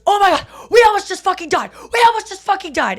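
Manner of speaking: loud, high mock panic yell; dad screaming. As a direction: imitate a shaken dad yelling it out. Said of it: "parents just screaming at each other".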